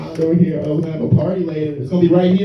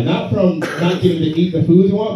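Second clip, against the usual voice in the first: ordinary delivery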